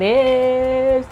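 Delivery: high, loud, drawn-out build-up; hammed-up announcer. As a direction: announce it like a game show host. Hyping up the snacks.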